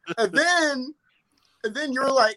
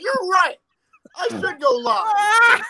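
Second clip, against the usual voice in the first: mock crying; 12 semitones higher, 5 dB louder, much slower than usual. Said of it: "I'm like".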